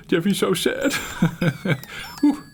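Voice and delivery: normal talking voice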